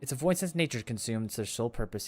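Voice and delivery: a hint of quiet authority; lightly voiced domain ruler. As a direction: read it as a restrained, solemn ruler.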